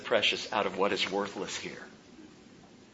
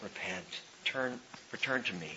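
much softer and lower, gently pleading; tender Father's voice; 3 semitones lower, 16 dB quieter than usual. Acted. Pastor voicing the Father to self-pitying listeners, tender and soft. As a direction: call them home quietly. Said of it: "hear the tender voice of your Father say".

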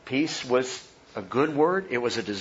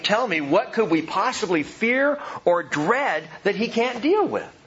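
ordinary talk, nothing marked

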